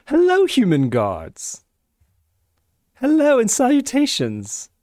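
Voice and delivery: lordly self-announcement, rising high on the salutations; a lightly voiced self-important wizard; pompous wizard greeting. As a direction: play the grand wizard introducing himself. Player greets guards in character.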